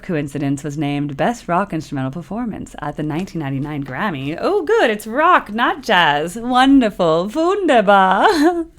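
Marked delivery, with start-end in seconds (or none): 4.4-7.3 excited. Pretend. Mock delight at rock label. 7.3-8.7 put-on German accent. Switches to German for fun.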